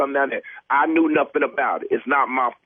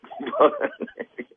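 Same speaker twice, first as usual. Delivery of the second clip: high laugh. Real, not acted. Caught in prank, bursts laughing.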